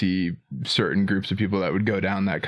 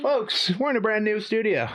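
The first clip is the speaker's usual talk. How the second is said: grand entrance announcement; 11 semitones higher than usual. Acted. Host announcer hype.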